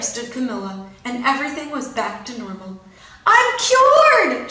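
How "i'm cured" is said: very high loud cry; a girl's high shout of triumph; joyful shout. A narrator's reading. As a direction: shout it with joy. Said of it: "she shouted".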